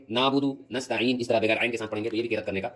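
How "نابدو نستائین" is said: "نعبد، نستعین" with plain vowels in place of ع; ع left out. Shown as a demonstration. Teacher shows the faulty reading, ع left out. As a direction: flatten ع to alif in "نعبد، نستعین". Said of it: "اگر آپ عین نہ نکالیں گے".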